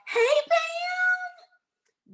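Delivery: high squealed hello; excited shout. Real, not acted. Host greets a viewer joining the live, squealing. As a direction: shriek her name with joy.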